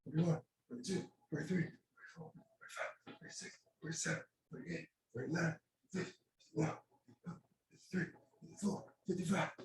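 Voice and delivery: straining whisper through a cramp; stomach cramps. Real, not acted. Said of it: "I'm cramping".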